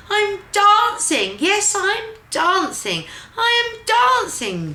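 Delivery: high, loud, slow joyful exclaim; joyful cry. Acted. Gerald cries out to himself, overjoyed and loud. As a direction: burst out with delight. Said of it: "Gerald cried".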